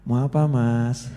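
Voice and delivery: slow, meek, timid question. Retold or imitated. Mocking a weak leader facing the enemy, slow timid meekness. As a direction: ask like a scared pushover. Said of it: "Ya ora pantes loh ya".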